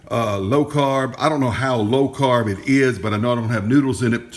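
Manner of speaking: ordinary delivery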